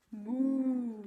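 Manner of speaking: long drawn-out moo; cow's moo. Acted. Reading the cow book to children, drawn-out mooing. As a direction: imitate a cow, holding the vowel. Animal sound from the storybook.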